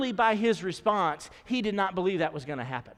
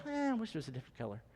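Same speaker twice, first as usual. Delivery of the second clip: unimpressed; 3 semitones lower, 10 dB quieter, much faster than usual. Retold or imitated.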